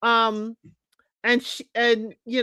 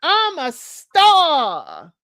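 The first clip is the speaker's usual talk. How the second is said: high, loud, very slow mocking drawl of I'm a star; mock-proud star proclamation; mock grandiose Joel; 5 semitones higher, 6 dB louder, much slower than usual. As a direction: stretch it out like a self-important star. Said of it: "Joel said".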